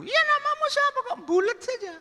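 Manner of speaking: squeaky-high falsetto questioning; woman's voice. Acted. Woman asking the visitor's name, high falsetto.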